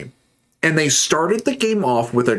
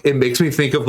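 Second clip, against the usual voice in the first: ordinary talk, nothing marked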